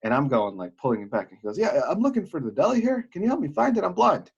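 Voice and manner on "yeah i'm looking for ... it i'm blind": high-pitched imitated request for help; pleading stranger. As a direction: play a passerby asking urgently, voice pitched up. Blind stranger pleads in retold story.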